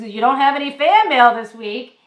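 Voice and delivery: loud, gruff Brooklyn mail guy ribbing her; teasing mail guy. Retold or imitated. Mail guy teasing a customer, loud and gruff. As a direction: belt it like a cheeky Brooklynite. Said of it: "and he's".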